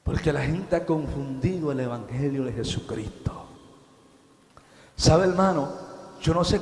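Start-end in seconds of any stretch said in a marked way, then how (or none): 0.0-3.3 hushed whisper. Acted. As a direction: drop to a whisper for the congregation. Preacher drops to hush.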